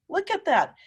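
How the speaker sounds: ordinary delivery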